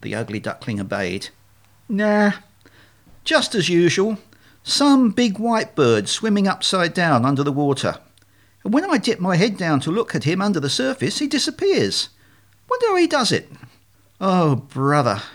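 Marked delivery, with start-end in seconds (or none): 1.9-13.5 high-pitched puzzled duckling voice; naive ugly duckling. Acted. 14.2-15.2 slow, weary aside; mildly exasperated swan; exasperated murmur. Acted. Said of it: "murmured the swan immediately behind".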